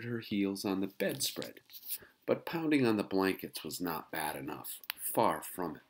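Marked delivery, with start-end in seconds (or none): none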